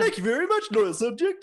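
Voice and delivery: high, grand royal voice; a vain king graciously thanking a subject; pompous king. Acted. King thanking his loyal subject, high and grand.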